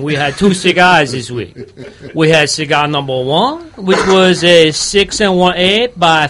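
hammed-up Louisiana bayou accent; put-on Cajun accent. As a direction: play a Cajun gator hunter, accent laid on thick. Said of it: "I still don't know whether it's Cajun".

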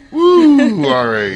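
loud high cheer; whooping for the room; excited whoop. Real, not acted. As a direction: let out a big celebratory whoop. Cheering outburst in studio.

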